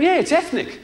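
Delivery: high, cheery mock promoter; mock promoter proudly saying yes; promoter's voice. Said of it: "he went".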